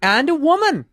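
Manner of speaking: slow, high, swooping lecture; high-pitched, laboured pronouncement; Jordan Peterson. Acted. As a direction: drag the words out, pitch climbing and falling. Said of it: "his Jordan Peterson impression".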